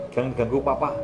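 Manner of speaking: usual speaking voice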